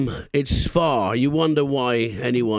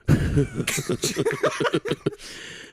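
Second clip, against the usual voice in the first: high-pitched laugh. Real, not acted. Laughing at bell end joke.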